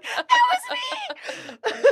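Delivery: very high, drawn-out squeal through laughter; laughing squeal. Retold or imitated. Owning up to strangers about the fight, high laughing squeal. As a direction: squeak it out slowly, cracking up. Gleeful punchline of retold story.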